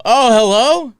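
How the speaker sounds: high, startled phone hello; surprised hello. Retold or imitated. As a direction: caught off guard answering. Retelling answering 911 call.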